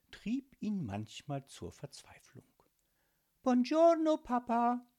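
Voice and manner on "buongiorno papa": high, sing-out daughter's greeting; high-pitched courteous little girl; polite young girl. A narrator's reading. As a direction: greet your father politely in a high child's voice. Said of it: "sagte Miri höflich".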